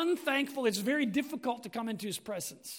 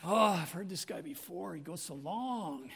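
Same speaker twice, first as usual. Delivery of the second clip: lower, weary mock grumble; bored complaint; 8 semitones lower, 6 dB quieter than usual. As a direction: sigh it out like a bored listener. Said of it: "you'll be like".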